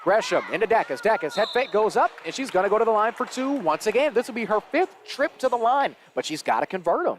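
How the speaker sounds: lively basketball commentary; live play-by-play. Real, not acted. Commentator following a drive to a foul, live play-by-play. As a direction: call the action as it unfolds.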